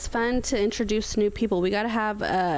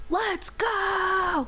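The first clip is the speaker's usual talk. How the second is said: higher, pumped-up cheer; hyped shout; 8 semitones higher than usual. Pretend. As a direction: fire everyone up. Hyped up after Red Bull talk.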